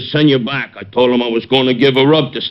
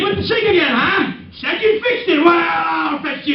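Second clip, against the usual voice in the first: angry threat. Acted. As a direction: shout it as you raise the chair. Threatens Kosak with a chair.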